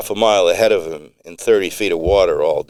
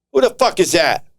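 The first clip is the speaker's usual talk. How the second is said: hostile challenge; 11 semitones higher than usual. Acted. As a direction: bark at the stranger. Tony challenges unknown voice.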